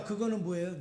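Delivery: ordinary talk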